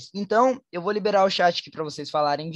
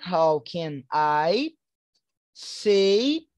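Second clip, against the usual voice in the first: very slow, word by word; slow model phrase; much slower than usual. Shown as a demonstration. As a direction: drill it slowly for students. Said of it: "como é que pronuncia". (bem devagar, palavra por palavra)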